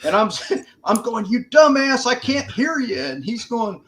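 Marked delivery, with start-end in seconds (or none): 1.2-3.0 high, loud mock yell at a buddy; shouting over gunfire in the retelling; retold yell. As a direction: yell it like you can't hear a thing. Yelling at buddy during firefight.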